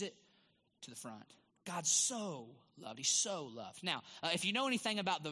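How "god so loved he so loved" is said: "so" stretched long in both short sentences; stress on SO. Real, not acted. Preacher driving home the verse, drawn-out "so". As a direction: repeat it slowly, leaning on each "so".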